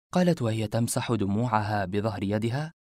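plain reading voice

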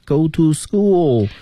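put-on childlike go to school; mock schoolchild. Shown as a demonstration. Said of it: "school这个词可不是这么念的".

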